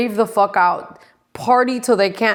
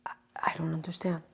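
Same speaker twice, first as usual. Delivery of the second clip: hushed exasperation; whispered disbelief; 3 semitones lower, 15 dB quieter, faster than usual. Real, not acted. To viewers, baffled by the board, whispered.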